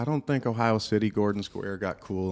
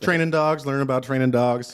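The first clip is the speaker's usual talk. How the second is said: raised, projected repeat; louder into the mic; 5 semitones higher, 8 dB louder than usual. Real, not acted. Guest redoing his answer after mic fix, loud and projected. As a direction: say it loud and higher, right up on the mic. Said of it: "Pull that mic a little closer".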